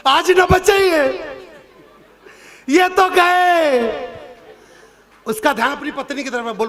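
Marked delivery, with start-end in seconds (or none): 0.1-4.1 higher, louder whimpering lament, dragged very slow on the end; hammed-up weeping wife at the farewell; overdone tearful wail, words stretched out; mock crying wife. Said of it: "रो क्यों रही है".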